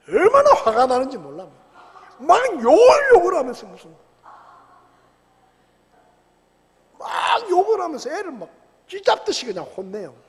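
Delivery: loud, high, heated sermon voice; 격앙된 설교; fiery preaching. Acted. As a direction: preach it with full indignation. Preaching at full force.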